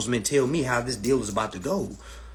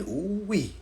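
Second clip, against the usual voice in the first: ordinary delivery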